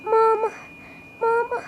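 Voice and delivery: calling out. Acted. Girl calling for lost mother.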